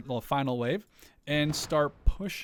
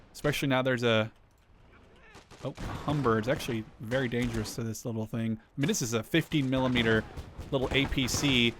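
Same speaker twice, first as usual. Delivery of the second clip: ordinary delivery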